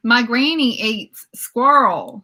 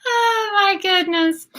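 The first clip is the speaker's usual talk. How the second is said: laugh-crying; 10 semitones higher than usual. Real, not acted. Laughing hard at gopher story.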